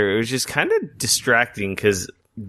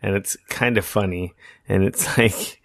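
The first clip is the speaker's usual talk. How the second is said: usual speaking voice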